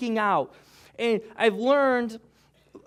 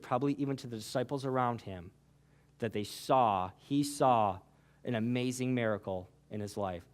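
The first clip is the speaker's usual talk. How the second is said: softer, lower, intimate; soft altar-call preaching; 9 semitones lower, 8 dB quieter than usual. Acted. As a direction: lower your voice and draw them in. Quiet altar call close.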